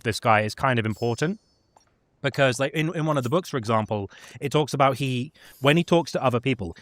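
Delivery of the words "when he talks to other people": ordinary talk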